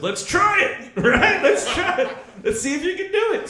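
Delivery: high-pitched, hammed-up excitement; eager mock challenge. Acting out eager challenge to girlfriend.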